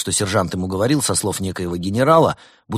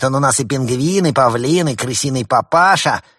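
bad cop rant; 5 semitones higher, 6 dB louder than usual. A narrator's reading.

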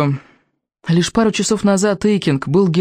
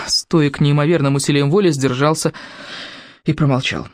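plain reading voice

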